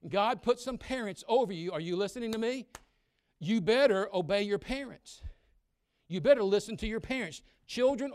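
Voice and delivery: loud, high preacher's shout; full-force sermon voice; fiery preaching. Acted. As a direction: preach it hard to the young people.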